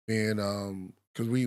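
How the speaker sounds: ordinary talk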